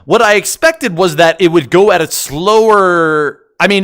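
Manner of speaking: ordinary talk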